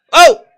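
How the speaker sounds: sudden loud, high "oh!"; startled shout. Real, not acted. Fan reacting to the match, startled loud shout. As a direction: yelp at a near thing.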